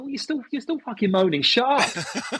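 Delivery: high-pitched retold retort; acted exasperation. Shooter telling the moaning player off, acted exasperation. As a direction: act fed up with a moaner.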